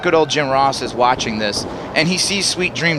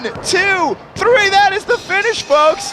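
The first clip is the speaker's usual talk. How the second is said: mock referee count, higher and building; excited count; 14 semitones higher than usual. Real, not acted. Commentator calling the three count, excited. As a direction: call the pin like a ringside announcer.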